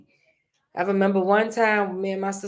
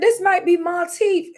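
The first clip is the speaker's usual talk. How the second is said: joking; 11 semitones higher, 6 dB louder than usual. Retold or imitated. Joking reply to sister.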